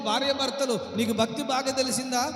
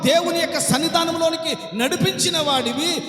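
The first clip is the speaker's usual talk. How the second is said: rising, louder preacher's rebuke; fiery preaching; 4 semitones higher, 4 dB louder than usual. Acted. Preacher rebuking the congregation, building to a shout.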